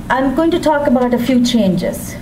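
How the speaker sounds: ordinary delivery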